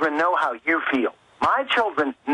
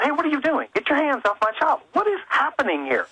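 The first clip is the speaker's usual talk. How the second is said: high-pitched indignant demand, retold; alarmed parent confronting; 10 semitones higher than usual. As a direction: re-enact confronting someone handling your child. Retells confronting screener touching child.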